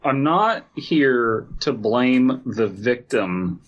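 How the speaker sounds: ordinary talk, nothing marked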